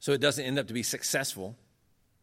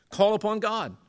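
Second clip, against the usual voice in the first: raised, high and forceful preaching; emphatic exhortation; 10 semitones higher, 6 dB louder than usual. Real, not acted. Lecturer closing his case to the audience, loud exhortation. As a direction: drive it home loudly, urging the listeners.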